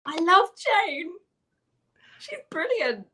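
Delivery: high, loud, drawn-out adoration; real gushing excitement. Real, not acted. Fan asked if she likes Jane, gushing excitement. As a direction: gush like a fan, pitch way up. Gushing about a favourite character.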